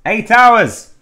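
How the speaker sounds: sudden high, very loud yell; exclaimed shout. Real, not acted. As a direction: burst out at full volume. Player bursts out with exclamation.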